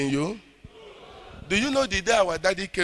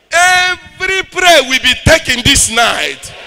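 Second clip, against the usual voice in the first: preaching at full force; 7 semitones higher, 11 dB louder than usual. Acted. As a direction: declare it over the crowd. Preacher declaring deliverance.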